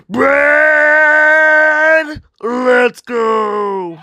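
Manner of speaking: high, drawn-out bellow. Shown as a demonstration. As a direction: bellow it loud, stretching each word. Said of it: "I can be louder".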